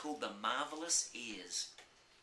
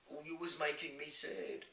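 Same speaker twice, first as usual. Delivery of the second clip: touch of sadness; 6 semitones higher than usual. A narrator's reading. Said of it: "rubbing his eyes".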